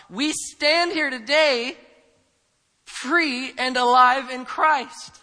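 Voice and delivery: loud, high, full-force sermon delivery; impassioned preaching. Acted. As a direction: preach it out, driving each key word home.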